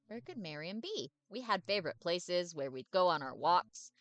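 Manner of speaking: loud, bouncy dog missing his owner; eager dog voice. Acted. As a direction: chatter on loudly like a lonely dog. Dave misses his owner.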